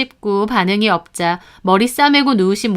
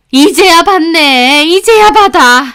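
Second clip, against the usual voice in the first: higher, louder, slow scolding; sarcastic mother-in-law; 6 semitones higher, 7 dB louder, slower than usual. Retold or imitated. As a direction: snap at her for finally picking up. (이제야 전화 받은 며느리를 타박하는 시어머니, 높고 큰 목소리)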